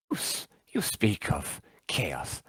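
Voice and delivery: hushed unvoiced whisper. Warrior whispering to the accuser. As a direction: lean in and breathe the words.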